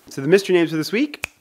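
usual speaking voice

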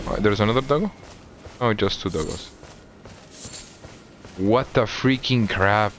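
normal talking voice

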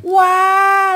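a sudden loud, high wah of amazement; surprised wah. Real, not acted. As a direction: gasp it out, amazed at twenty years. Hearing of twenty years abroad. (kaget, wah yang keras dan tinggi)

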